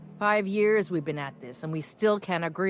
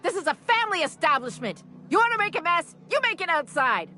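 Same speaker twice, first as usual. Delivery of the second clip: high, raised-voice reprimand; angry scolding; 10 semitones higher than usual. Acted. Owner ordering brawlers out of a family establishment, angry. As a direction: break up the brawl in a high, forceful voice. Owner breaking up a bar fight.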